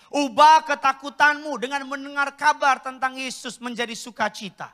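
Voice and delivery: fervent preacher's build; rising sermon fervour. Acted. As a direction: lift the church toward hope.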